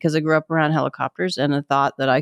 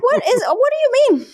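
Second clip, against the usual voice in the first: mock confusion. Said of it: "I'm like".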